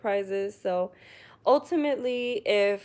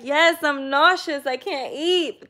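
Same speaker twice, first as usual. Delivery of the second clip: higher, louder happy moaning; cheerful fake misery, louder; mock happy complaint; 6 semitones higher, 8 dB louder than usual. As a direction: brag about being sick, half laughing. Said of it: "I'll be like".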